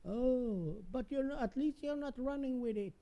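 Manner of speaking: high-pitched scolding mom. Acted. Child and mom scissor skit.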